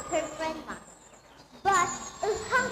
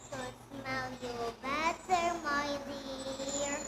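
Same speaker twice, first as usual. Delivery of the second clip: low, soft, slow fake granny voice; the wolf pretends to be grandma, low and hushed; wolf posing as grandma; 5 semitones lower, 7 dB quieter, much slower than usual. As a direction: coo sweetly like a disguised wolf.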